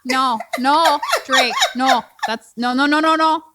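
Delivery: a fussy Minnesota mother refusing, half-laughing; Minnesotan accent. Retold or imitated. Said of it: "you sound like a Minnesotan".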